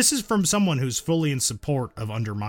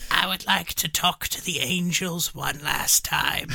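high, put-on voice for a dying wish; put-on character voice; 8 semitones higher than usual. Speaking as an old occultist, high put-on character voice. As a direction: act it in a high, odd voice. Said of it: "That's a good voice".

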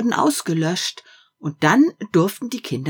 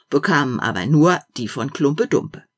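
ordinary delivery, nothing marked

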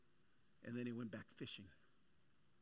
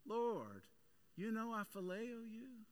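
a slow, higher plea; Peter pleading; 8 semitones higher, slower than usual. Retold or imitated. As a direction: earnestly insist on your devotion. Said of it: "Peter's like".

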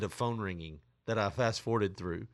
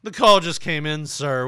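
louder, rude mock rep; 4 semitones higher, 11 dB louder than usual. Caller mimics scam rep, louder. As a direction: imitate the rep's curt brush-off. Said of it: "I love that".

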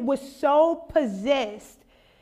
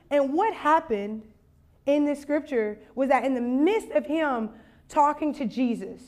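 ordinary talk, nothing marked

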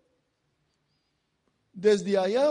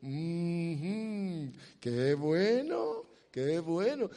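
drawn-out, lower hum of approval; lightly voiced, wry divine approval; amused, smiling God; 3 semitones lower, much slower than usual. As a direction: imitate God chuckling indulgently with a smile. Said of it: "Se ha de sonreír Dios y dice".

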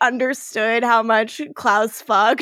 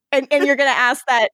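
ordinary talk, nothing marked

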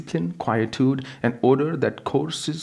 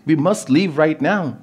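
higher, louder, pressing; urgent panic; 6 semitones higher, 5 dB louder than usual. Acted. Wife urging her husband to flee now, panicked. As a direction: insist in panic that they leave.

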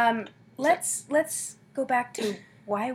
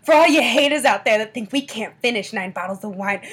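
ordinary talk

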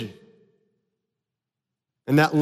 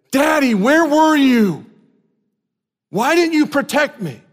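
imitating a small son calling for daddy after a fall; crying little boy; 7 semitones higher than usual. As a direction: whimper it like a hurt child. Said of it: "started crying, he would say".

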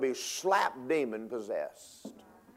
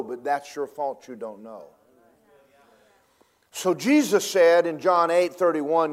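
ordinary talk

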